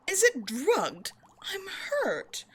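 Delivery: high-pitched wounded indignation; mock offence. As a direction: act hurt by the accusation, pitch swooping up. Feigns offence at captive's suspicion.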